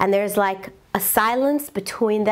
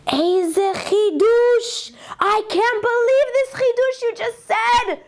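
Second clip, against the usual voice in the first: slow, much higher voice of astonished wonder; emotional amazement; 11 semitones higher, slower than usual. Retold or imitated. Man marvels at the Devar Torah, amazed and emotional. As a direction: marvel at the idea, deeply moved. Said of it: "gets very emotional".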